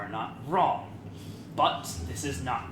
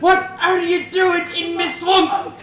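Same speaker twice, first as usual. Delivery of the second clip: much higher and louder, laughing Shrek; Shrek impression; 16 dB louder than usual. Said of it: "your Durnan voice is Shrek".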